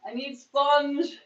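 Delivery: high, loud and slow plea in a put-on voice; mock needy voice. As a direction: whine I need sponge in a playful put-on voice. Repeats the line in a put-on voice.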